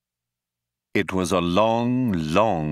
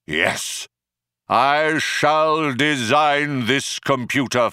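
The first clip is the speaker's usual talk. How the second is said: grand, sustained oratorical intoning; declamatory; 2 semitones higher than usual. A narrator's reading. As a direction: proclaim it like a solemn prophecy. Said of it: "regaining its accustomed declamatory tones".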